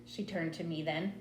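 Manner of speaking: usual speaking voice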